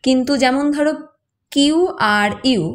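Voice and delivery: normal talking voice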